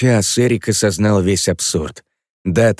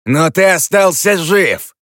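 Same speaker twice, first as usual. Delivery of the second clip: much higher, louder, slow retort; heated retort; 11 semitones higher, 7 dB louder, slower than usual. Acted. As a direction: fire back in the heat of the argument.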